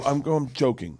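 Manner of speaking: usual speaking voice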